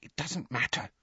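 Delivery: put-on hushed, high girl's voice; soft and high, brushing it off; whispered. Hermione calming her friends, whispering. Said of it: "Hermione whispered".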